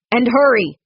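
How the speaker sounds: urgent call after a rider; called out loud after the departing foreman. A narrator's reading. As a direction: shout it after him. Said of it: "Connor called after him".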